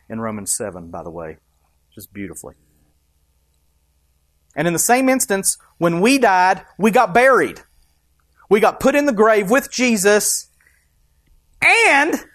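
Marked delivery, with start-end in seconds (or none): 4.6-12.2 loud, rising preacher's build; preaching at full force. Acted. Pastor driving home burial with Christ, loud preaching. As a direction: preach it out to the congregation.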